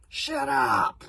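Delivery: high, loud snap; angry roar. A narrator's reading. Chief berserk roaring at the wondering crowd. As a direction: roar them silent. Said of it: "roared the chief berserk".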